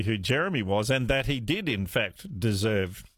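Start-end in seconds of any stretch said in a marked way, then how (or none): none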